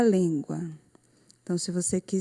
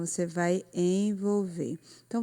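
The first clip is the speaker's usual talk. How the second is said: ordinary delivery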